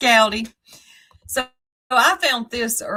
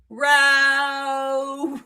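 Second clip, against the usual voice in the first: high, drawn-out imitation yowl; mock scream; 7 semitones higher, 3 dB louder than usual. Host imitating screaming woman from a gif, mock scream. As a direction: hold one long comic screech. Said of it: "And she's screaming".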